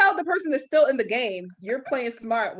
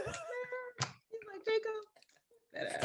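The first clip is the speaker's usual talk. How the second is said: very soft, high offhand voice; hushed, nonchalant retold line; imitating casual put-down; 13 semitones higher, 15 dB quieter, slower than usual. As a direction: toss it off quietly, like it's nothing. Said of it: "He said it so casually".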